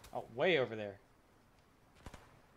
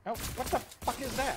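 alarm shout; 9 semitones higher, 7 dB louder, slower than usual. Real, not acted. Startled by enemy behind.

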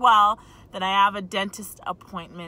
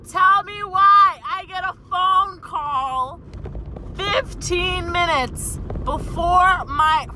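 slow, loud, higher outraged demand; indignant rant; 9 semitones higher, 6 dB louder, slower than usual. Real, not acted. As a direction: spell out the outrage word by word. Ranting at the boss to viewers.

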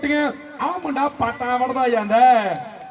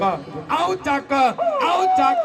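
drawn-out call; 5 semitones higher, much slower than usual. Real, not acted. Calling out to players.

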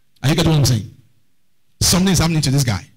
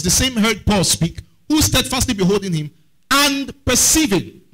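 scripture reading; 4 semitones higher than usual. Real, not acted. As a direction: draw out the verse, lifting the end. Preacher reading scripture aloud.

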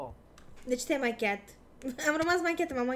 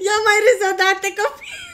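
shriek; 6 semitones higher, 9 dB louder than usual. Real, not acted. Shrieking at friend on call.

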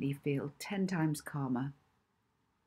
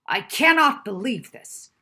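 put-on furious shout, much louder, higher, American accent; bellowing American; 6 semitones higher, 12 dB louder than usual. A narrator's reading. Angry professor bellowing at the librarian, full furious shout. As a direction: roar it at the librarian in a rage. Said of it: "the be-, speaker bellowed".

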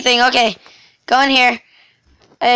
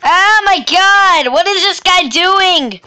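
high furious shouted threat; angry yell; 6 semitones higher, 4 dB louder than usual. Real, not acted. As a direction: yell in rage at the attacker.

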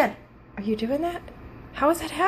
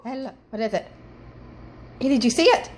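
usual speaking voice

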